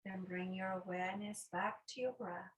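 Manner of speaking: guided meditation. Acted. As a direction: guide them unhurriedly into noticing the breath. Leads a breathing meditation.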